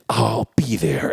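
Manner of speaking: low, slow, intense vow. Retold or imitated. D'Artagnan accepting a duel with one finger out, intense. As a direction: stretch it out slowly with mock menace. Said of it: "And it's, like, very intense".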